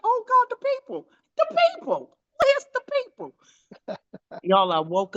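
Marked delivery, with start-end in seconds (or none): 0.0-3.3 very high squealing panic; hammed-up frantic squeal; mock panic. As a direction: squeak out the panic of waking too late. Waking up panicked, retold.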